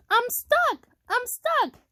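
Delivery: high little truck's plea; crying out. A narrator's reading. Stuck truck calls for help, crying out. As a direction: cry it out high, falling at each end. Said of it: "cried the little blue truck".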